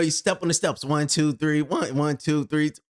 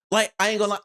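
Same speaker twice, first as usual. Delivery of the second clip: normal talking voice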